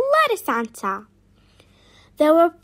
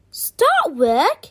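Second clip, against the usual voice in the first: louder, disbelieving question; gasped surprise; 3 semitones higher, 5 dB louder than usual. A narrator's reading. Chip is told to work by the queen, gasped surprise. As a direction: echo it back in shock. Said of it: "gasped Chip".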